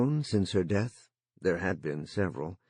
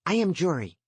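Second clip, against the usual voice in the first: put-on eager, higher boyish voice; young boy voice; 9 semitones higher, 3 dB louder than usual. A narrator's reading. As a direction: play the lonely boy, keen to chat. Jory speaks through the line.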